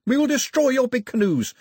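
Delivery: high, loud shouted ultimatum; sustained high-pitched battle shout; yelling. A narrator's reading. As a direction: yell a challenge over the confusion. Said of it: "Pilou yelled in the confusion".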